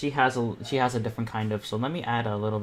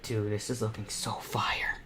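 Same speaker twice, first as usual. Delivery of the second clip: soft slow whisper; whispered aside; 7 dB quieter, slower than usual. Real, not acted. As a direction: hush it like a secret compliment. Quiet aside over music.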